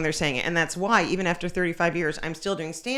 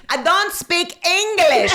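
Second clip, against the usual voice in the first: high, loud and slow broken English; foreign man's voice; 11 semitones higher, 8 dB louder, slower than usual. Retold or imitated. Comic quoting the laughing audience member, high and slow. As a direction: spell it out slowly like a foreigner. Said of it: "And he said".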